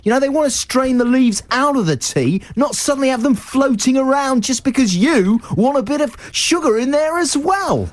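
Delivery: high put-on Scottish voice; lecturing dragon, pitched high in Scottish; Scottish accent. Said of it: "say it in a Scottish accent".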